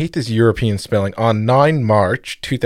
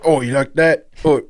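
tough guy taunt; 4 semitones higher than usual. Retold or imitated. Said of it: "And he's like".

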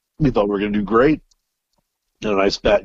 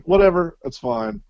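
dismissive; 10 semitones higher than usual. Retold or imitated. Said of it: "We're like".

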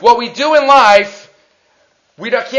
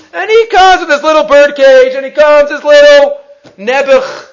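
loud, high, insistent pitying lament; emphatic, heartfelt storytelling at high pitch; impassioned pity; 7 semitones higher, 8 dB louder than usual. Retold or imitated. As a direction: build up the pitiful picture with raised voice. Pitying the poor man's small offering.

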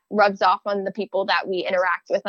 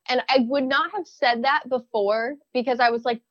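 ordinary talk, nothing marked

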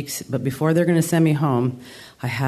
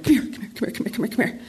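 rapid, higher-pitched repeated beckoning; urgent calling over; 5 semitones higher, 3 dB quieter, much faster than usual. Retold or imitated. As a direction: act out frantically summoning someone, words tumbling together. Retells urgently calling sister over.

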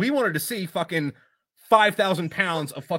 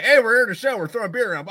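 1 mock party hype; 8 semitones higher, 6 dB louder than usual. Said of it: "it was never like".

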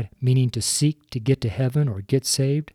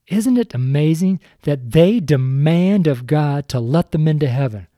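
normal talking voice

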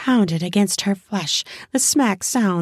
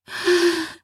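high sigh; 11 semitones higher than usual. Acted. She sighs and melts.

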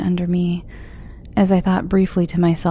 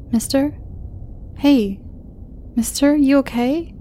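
put-on high, young girl's voice, gently worried; a teen girl nudging a stranger awake; 8 semitones higher than usual. A narrator's reading. Girl waking the crashed driver, high and worried. Girl wakes injured narrator.

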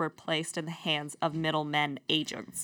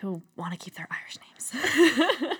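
mock pity whisper; 4 semitones higher than usual. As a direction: whisper it like a tragic bedtime tale. Mock-sad whisper about children.

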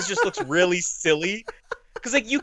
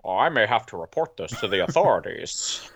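low mock voice; 9 semitones lower than usual. Voicing film character.

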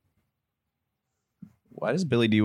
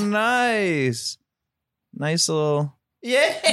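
excited; 9 semitones higher, much slower than usual. Real, not acted. Delighted at cute creatures.

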